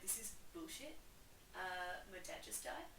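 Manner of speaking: put-on a mopey teen under his breath; sulky mutter. Hamlet grumbling to his mother and uncle. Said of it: "And he's like".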